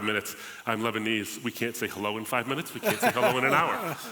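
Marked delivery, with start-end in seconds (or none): 2.7-4.1 laughing, pitched up on the punchline. Real, not acted. Guest to host, laughing at his own Lebanese joke. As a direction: laugh through the topper of the joke.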